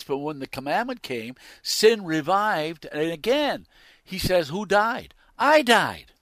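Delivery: very loud, high scripture declamation; full-force preaching. Real, not acted. Preacher declaiming Paul's words on sin and law, full-force preaching. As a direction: thunder it out to the congregation, stretching key phrases.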